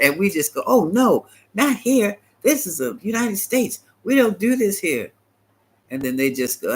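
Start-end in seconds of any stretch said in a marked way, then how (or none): 0.7-5.1 mock naive denial. Mocking Americans' naive reply.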